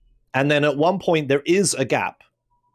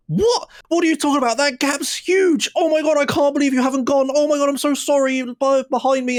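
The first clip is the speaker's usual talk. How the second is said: shocked instructor; 12 semitones higher than usual. Retold or imitated. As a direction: squeal it like a horrified instructor. Driving instructor's shocked scolding rant.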